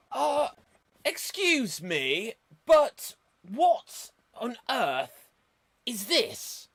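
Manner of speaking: high, slow, pompous schoolboy voice; Billy Bunter voice. Acted. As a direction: huff like an indignant posh schoolboy. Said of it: "I am Billy Bunter".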